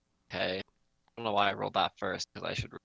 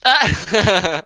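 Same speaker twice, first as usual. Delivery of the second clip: startled yelp; 11 semitones higher, 14 dB louder than usual. Real, not acted. Stepped on a friend, startled.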